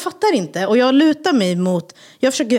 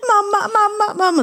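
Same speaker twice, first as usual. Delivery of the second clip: high crying mamma calls; crying child; 12 semitones higher than usual. Retold or imitated. Daughters cry for their mother, high imitated crying. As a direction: imitate the scared girls. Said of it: "de bara".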